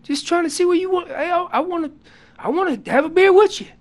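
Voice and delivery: high, loud hail to a buddy; friendly calling out. Acted. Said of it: "And that's me saying".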